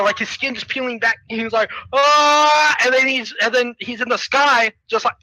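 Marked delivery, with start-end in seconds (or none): 1.9-2.8 loud, high, held "aah"; mock scream. Hero with skin peeling back, mock scream. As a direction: holler as if blasted by wind.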